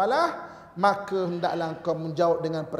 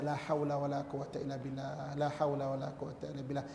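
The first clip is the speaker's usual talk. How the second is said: 1 soft dhikr; 5 semitones lower, 8 dB quieter than usual. Shown as a demonstration. Quiet repeated dhikr.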